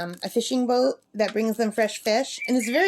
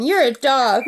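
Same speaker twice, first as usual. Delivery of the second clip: mock squabble; 3 semitones higher, 7 dB louder than usual. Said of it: "they'd argue".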